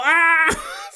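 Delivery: loud, high mock scream; sudden high cartoon shriek; freaked-out scream. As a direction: yell out in hammed-up panic. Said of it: "freaking out".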